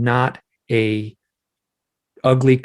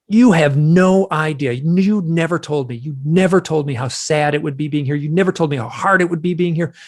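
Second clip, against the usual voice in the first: angry; 4 semitones higher than usual. Retold or imitated. Man under hypnosis confronting the gray beings, angry. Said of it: "I got so angry".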